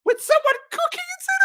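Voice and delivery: loud, squealing incredulity; astonished. Retold or imitated. Passenger realises someone cooks on board, astonished shriek. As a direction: screech it in comic disbelief.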